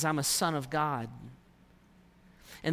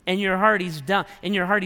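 ordinary talk, nothing marked

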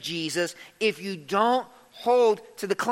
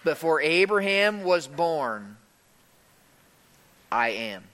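weighty pause before a deep, final "I am"; solemn declaration; 4 semitones lower than usual. Retold or imitated. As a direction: hold back "I am", then drop it low. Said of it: "Jesus answered".

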